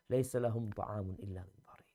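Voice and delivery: hushed recitation, breath only; whispered verse. Acted. Preacher whispers the verse's ending to the congregation, hushed.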